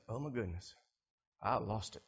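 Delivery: very quiet, low voice; calmed-down realization. Acted. Someone realizing they blew up in anger, hushed and low. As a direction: let it out softly and low, as if just cooled down. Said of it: "you cool down and you calm down".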